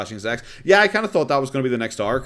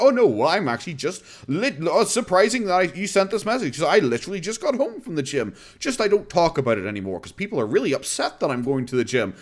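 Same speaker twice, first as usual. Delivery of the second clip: mocking Boogie; 6 semitones higher than usual. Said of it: "He's like".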